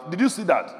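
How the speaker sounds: ordinary delivery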